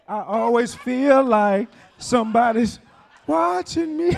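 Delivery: slow, loud spooked cry; paranoid sinner. Retold or imitated. Sinner voicing his fear of being watched, loud and slow. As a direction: call it out like someone who feels watched.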